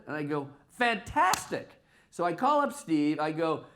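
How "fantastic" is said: delighted. Retold or imitated. Retold reply, delighted.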